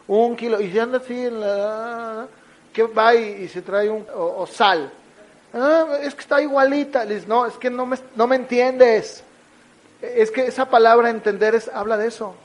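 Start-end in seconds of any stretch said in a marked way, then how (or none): 5.5-7.0 voz de niño excusándose, algo más aguda. Retold or imitated. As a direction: se justifica como niño. Niño se disculpa por el error. (niño que se excusa)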